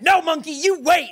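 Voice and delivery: shouting. Retold or imitated. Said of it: "And then you went".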